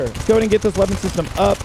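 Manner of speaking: ordinary talk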